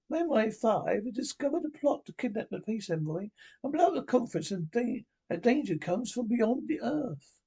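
high, prim and imperious; Margaret Thatcher impression. Prime Minister scolding the Doctor, high and prim. Reader voices Miss Thatcher's lines.

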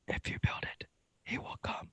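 breathy whisper from the sky; movie theater whisper. Retold or imitated. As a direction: whisper it like a ghostly voice. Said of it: "the voice in the sky says".

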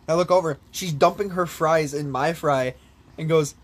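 ordinary delivery

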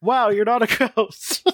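high, drawn-out, hammed-up relief; mock amazement. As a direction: marvel in an exaggerated high voice. Mocks the book's relieved characters.

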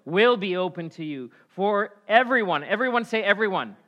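loud, high-pitched sermon delivery; full-force preaching. Real, not acted. Preacher urging the congregation to ask God, preaching at full force. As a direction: preach it out loud with big emphatic stresses.

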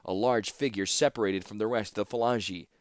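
ordinary delivery, nothing marked

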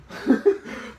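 airy burst of laughter; breathy laugh. Real, not acted. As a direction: let out a quick airy laugh.

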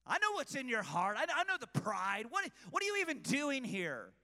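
put-on a touch of sneering older brother; condescending. Eliab belittling his little brother David, mildly condescending. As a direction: look down on him as you say it. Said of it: "condescension in Eliab's voice".

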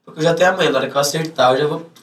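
ordinary delivery

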